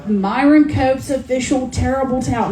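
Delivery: hammed-up Pittsburgh accent like a Steelers broadcaster. Author shows off the Steelers towel in a Pittsburgh accent. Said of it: "so I can do that accent".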